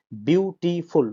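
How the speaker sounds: বিউটিফুল broken into three beats, বিউ-টি-ফুল; syllable by syllable. Shown as a demonstration. Teacher showing how the word divides, বিউটিফুল syllable by syllable. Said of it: "আমরা উচ্চারণ করি কি?".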